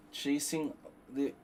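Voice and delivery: ordinary talk, nothing marked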